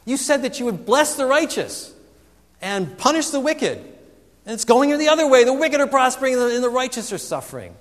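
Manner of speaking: high, loud, pleading rant at God; desperate angry tirade. Acted. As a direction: cry out to God, frustrated and desperate. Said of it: "almost angry tirades, or their desperate tirades".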